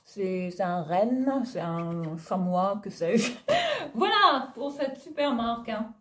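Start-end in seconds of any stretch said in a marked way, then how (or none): 3.4-3.9 a short giggle that jumps up high; real amused high giggle; high laugh. Real, not acted. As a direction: burst into a high little laugh. Laughing at her own ignorance.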